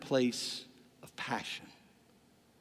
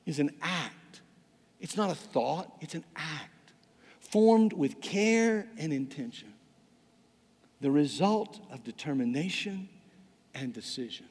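slow definition with long pauses between phrases; slow, deliberate reading. Shown as a demonstration. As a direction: spell out each phrase deliberately. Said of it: "I'm gonna read it really slowly".

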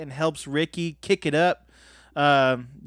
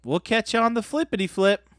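goofy sign-off; 8 semitones higher, faster than usual. Acted. Host signing off the podcast in a goofy voice. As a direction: toss off a goofy catchphrase.

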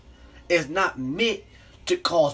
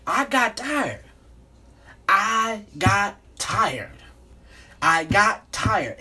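each I got tired pushed harder, slower and louder; emphatic repetition; 5 semitones higher, 3 dB louder, much slower than usual. Real, not acted. Vlogger venting to his viewers, repeating I got tired louder and slower. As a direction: lean on I got tired, louder every time. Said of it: "repeating for emphasis".

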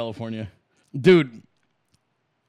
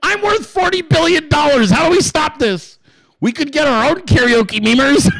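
hammed-up indignant boss, higher; outraged billionaire; 6 semitones higher than usual. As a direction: play a rich boss losing his temper. Acted billionaire outraged at memers.

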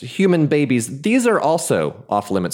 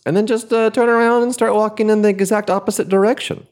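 higher and faster, excited; high excited rush; 10 semitones higher, 3 dB louder, faster than usual. Acted. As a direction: rush it out, pitched up. Excited rambling escape.

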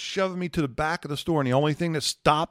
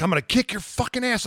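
angry threat; 8 semitones higher than usual. Retold or imitated. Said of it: "I'm like".

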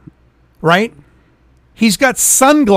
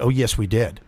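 lower, softer imitation of the clerk; creepy clerk; 7 semitones lower, 6 dB quieter than usual. Clerk contradicting a customer at the counter, lightly imitated. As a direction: lightly mimic a clerk's low reply. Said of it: "This is how she talked to me".

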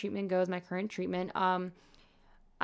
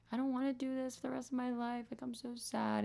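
soft, higher, tearful retelling; retold crying; 5 semitones higher, 7 dB quieter than usual. Telling her boyfriend she's worn out, softly tearful. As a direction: let it sound like you're still a bit teary. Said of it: "I was crying to my boyfriend".

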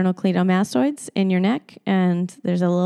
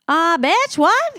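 high, loud indignant outburst; outraged; 9 semitones higher, 4 dB louder, slower than usual. Real, not acted. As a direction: blurt it out in disbelief. Outraged at her excuse.

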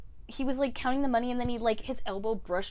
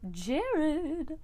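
high, drawn-out searching call; half-voiced "where are you" call; 9 semitones higher than usual. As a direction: call his name high, without raising volume. Acted calling out for absent Jared.

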